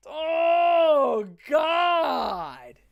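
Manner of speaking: loud, high cry of pain; anguished shout. Real, not acted. Reacting to a cringe lyric, loud pained wail. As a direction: wail it like the song hurts.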